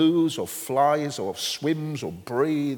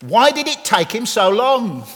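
put-on child's question; 7 semitones higher, 8 dB louder than usual. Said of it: "and he said".

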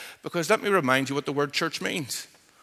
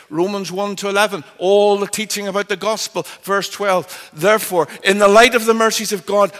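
loud, raised preacher's voice; forceful, higher-pitched exposition; emphatic preaching; 4 semitones higher, 6 dB louder than usual. Real, not acted. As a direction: hammer the argument home from the pulpit. Preaches the text at full force.

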